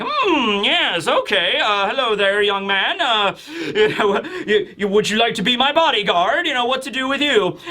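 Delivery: swooping, stammering role voice with a chuckle; Vegapunk. Acted. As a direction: hem and haw in a put-on pondering voice. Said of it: "Vegapunk's just like".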